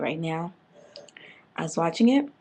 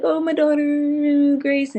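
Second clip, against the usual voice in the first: mock crying; 8 semitones higher, 5 dB louder, much slower than usual. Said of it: "she's crying".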